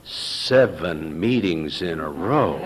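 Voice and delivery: low, slow, half-laughing echo; stunned disbelief. Retold or imitated. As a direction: repeat it like you can't believe it. Incredulous repeat of the question.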